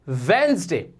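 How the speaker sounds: ordinary talk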